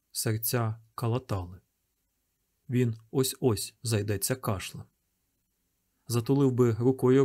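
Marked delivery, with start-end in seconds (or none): none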